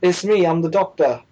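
cheeky "Oi" in mock British; British accent. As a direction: do an eager amateur British accent. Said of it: "really good British accent at one point".

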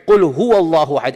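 reciting Quran; Quran recitation. Acted. Recites the verse again.

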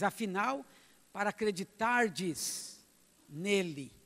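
loud, measured Bible reading to the church; scripture reading. Acted. Preacher reading the parable aloud to the church, solemn and loud. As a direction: read the verses with weight.

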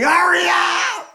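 high, loud, slow mock shout; retold yell. Retelling the drunk yelling in a stalled line, loud. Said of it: "But he's yelling".

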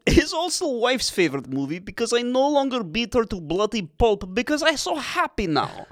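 broken English like a Soviet comrade; Russian accent. Pretend.

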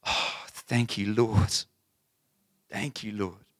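soft, slow murmured thanks; prayerful. Real, not acted. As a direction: breathe a quiet prayer. Quiet prayer.

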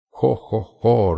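loud high ho ho ho; booming laugh. A narrator's reading. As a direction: laugh it out big and gleeful. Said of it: "rió el dragón".

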